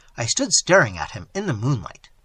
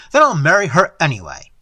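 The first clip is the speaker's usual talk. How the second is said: loud, fast defiance; quick, heated comeback; angry retort; 8 dB louder, faster than usual. A narrator's reading. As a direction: throw it back at him angrily. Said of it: "I retorted angrily".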